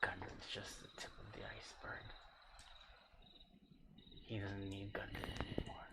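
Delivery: fast urgent whisper. Acted. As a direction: hurry the secret out in a whisper. Captive whispering secrets.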